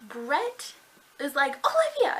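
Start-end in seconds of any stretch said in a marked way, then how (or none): 1.7-2.2 high, loud call of a name. Retold or imitated. Brett calling Olivia to stop her, calling out.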